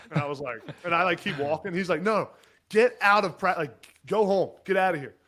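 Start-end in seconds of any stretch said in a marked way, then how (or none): 2.0-5.2 much louder, higher furious order; screaming. Retold or imitated. Coach screaming at player to leave practice, furious and loud. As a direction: throw him off the field at full volume. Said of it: "he's just screaming".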